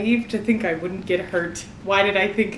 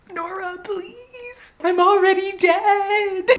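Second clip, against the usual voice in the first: high, drawn-out plea to the author; pleading wail; 10 semitones higher, slower than usual. Real, not acted. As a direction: wail it slowly, begging for mercy.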